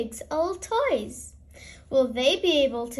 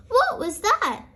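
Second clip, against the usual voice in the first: high, startled what was that; startled by a noise behind her; surprised question; 7 semitones higher, 3 dB louder than usual. Acted. Granny hears the plane fly by: startled high question. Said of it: "asks Granny Pig".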